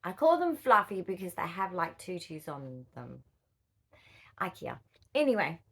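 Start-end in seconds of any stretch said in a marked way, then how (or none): none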